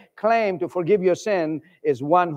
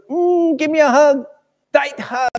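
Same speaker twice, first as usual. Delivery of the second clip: fond daddy; 11 semitones higher, 7 dB louder than usual. Pretend. Father asks for a hug.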